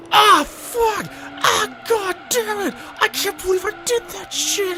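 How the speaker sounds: high, strained cursing in a put-on voice; furious outburst. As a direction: play the guy losing it at himself. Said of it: "he just went".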